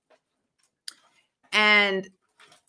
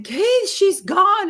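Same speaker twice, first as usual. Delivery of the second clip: panicked call; 12 semitones higher, 5 dB louder, much slower than usual. Retold or imitated. Panicked retelling of lost puppy.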